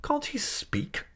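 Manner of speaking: very high, drawn-out exasperation; exasperated aunt at her wits' end; impatient. A narrator's reading. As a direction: squeeze it out high and slow. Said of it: "cried my aunt impatiently".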